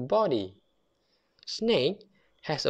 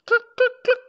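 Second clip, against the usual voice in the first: very high, loud clucks; hen clucking; 8 dB louder, much faster than usual. Pretend. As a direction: cluck like a hen for little kids. Hen sound for children.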